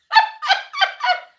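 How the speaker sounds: very high, loud real laugh; high squeal laugh. Real, not acted. Laughs at correcting herself.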